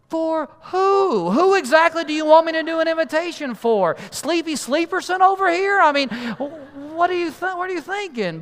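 high-pitched, exasperated disbelief; mock-outraged bewilderment; incredulous. Incredulous retort to grandpa's request.

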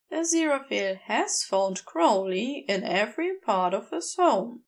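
ordinary delivery, nothing marked

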